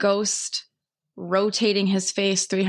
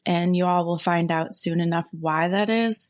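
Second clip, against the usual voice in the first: normal talking voice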